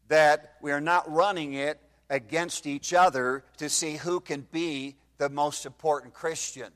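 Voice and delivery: normal talking voice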